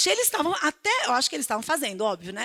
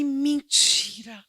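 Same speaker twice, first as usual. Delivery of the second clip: slow, stretched ironic "Mentira"; drawn-out mock disbelief; 2 semitones lower, much slower than usual. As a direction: drag it out in fake shock. Pastor's ironic reply. ("Mentira" arrastado, com espanto irônico)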